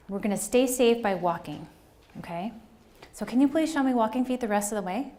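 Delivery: put-on kindly redirect a running kid; elementary teacher voice. Shown as a demonstration. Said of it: "This is my elementary school teacher voice".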